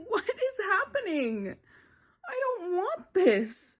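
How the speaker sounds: high, whimpering protest; distressed whine. Real, not acted. Reader dreading a beloved character's death, high distressed whine.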